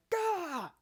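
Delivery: high, loud mock horror yelp; retold horrified yell. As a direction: shriek like the movie just grossed you out. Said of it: "were like".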